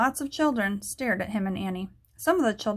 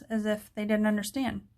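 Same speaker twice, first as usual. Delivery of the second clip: plain reading voice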